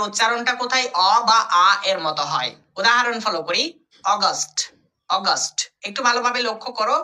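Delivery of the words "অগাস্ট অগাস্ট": অগাস্ট with an open o-sound at the start; pronunciation demo. Shown as a demonstration. Teacher modelling অগাস্ট for students. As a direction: say অগাস্ট slowly, opening with o. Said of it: "এ ইউ এর উচ্চারণ করছি অ".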